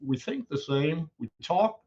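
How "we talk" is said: ordinary talk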